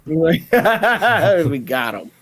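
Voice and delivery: slow, laughing through words. Real, not acted. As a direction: draw it out while laughing. Laughing at the joke.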